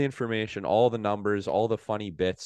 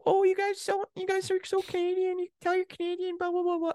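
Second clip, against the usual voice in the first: very high falsetto parody; high, babbling mock imitation; mocking Americans. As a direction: mimic gushing strangers in a squeaky voice. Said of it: "So they're like".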